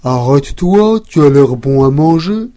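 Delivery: loud, lowing cow voice; cow calling. Acted. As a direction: call out like a hungry cow. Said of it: "La vache l'appela".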